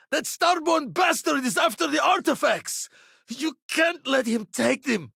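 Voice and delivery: very high, strained warning yell; desperate shout. Acted. Warning that Starborn is after the artifacts, desperate shout. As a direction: cry out urgently to stop a thief.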